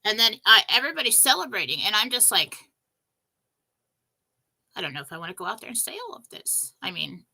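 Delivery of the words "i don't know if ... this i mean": fast, hushed self-doubt; nervous whisper. Retold or imitated. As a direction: confide your fear in a whisper. Doubting herself, private worry.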